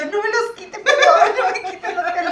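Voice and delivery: laughing mock plea. Fainted sister pleading over socks.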